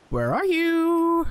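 long drawn-out high call; drawn-out high shout for a missing man. Real, not acted. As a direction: call out across the area, stretched. Calling out for hidden NPC.

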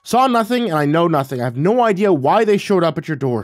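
high, flustered denial; tripping over words, defensive and rattled; stammering. Acted. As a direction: protest innocence in a loud panic. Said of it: "I stammered".

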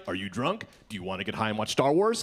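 lazy, eager roommate rattling it off; roommate Anthony. Retold or imitated. Roommate Anthony welcomes him home drunk, low and quick. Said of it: "my roommate Anthony is like".

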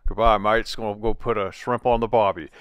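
put-on a light, jokey Australian accent; hammed-up Aussie goodbye in an Australian accent; playful Australian accent sign-off. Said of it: "in your best Australian accent".

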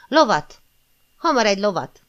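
loud urgent call for a horse; shouted command. A narrator's reading. Joan shouting to the crowd for a horse, loud call. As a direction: bark it out over the crowd. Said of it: "Zsán meg oda kiáltotta".

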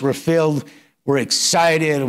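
ordinary delivery

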